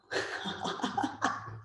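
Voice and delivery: soft breathy laugh. Real, not acted. Guide laughing after naming everybody losing control, soft laugh. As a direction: let out a light, airy laugh.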